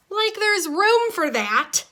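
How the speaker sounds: high, slow sarcastic scoff; an exasperated fish scoffing at the news. Acted. Fish complains about overcrowding.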